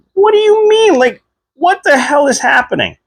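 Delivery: high squeaky disbelief, voice jumping way up; retold bewilderment. Patient asking the doctor what is going on, in a high squeaky disbelief. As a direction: replay how lost and alarmed you felt.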